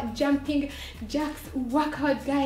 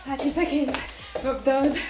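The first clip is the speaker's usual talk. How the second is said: workout coach; 3 semitones higher than usual. Acted. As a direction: pump up the class like a coach. Energetic workout cues.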